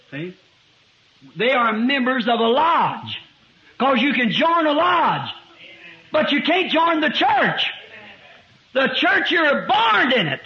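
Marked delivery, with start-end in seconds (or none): 1.4-10.4 emphatic preaching. Real, not acted. Preaches at raised pitch.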